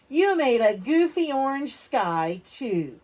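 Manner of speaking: put-on loud, slow, teasing kid; mocking classmate. Andrew jeering at Sophie's blue tree, loud and slow. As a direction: jeer it at her across the table.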